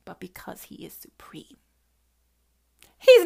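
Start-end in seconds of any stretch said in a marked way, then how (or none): 0.1-1.6 hushed sermon whisper. Real, not acted. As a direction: whisper it like a secret truth. Reverent point whispered.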